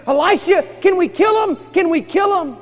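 loud, very high, urgent mock begging; acted eager pleading. King begging prophet for permission, acted eagerness.

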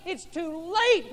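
too late stretched slow and loud; Martha's despair, slowed and pleading; slow drawn-out plea. Acted. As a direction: drag out each word of It's too late. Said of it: "She's pleading".

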